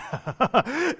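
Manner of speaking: a high burst of real laughter; laughing. Real, not acted. Preacher laughing at his joke about Elkanah. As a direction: laugh at your own joke.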